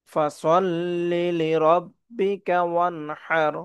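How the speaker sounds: melodic verse recitation; chanted tajweed; Quran recitation. Shown as a demonstration.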